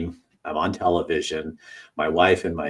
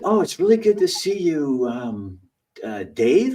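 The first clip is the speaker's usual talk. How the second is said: high, sugary mock-friendly voice; fake polite; 9 semitones higher, 3 dB louder than usual. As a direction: pretend you barely know him. Pretends not to remember him.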